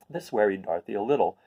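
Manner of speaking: ordinary delivery, nothing marked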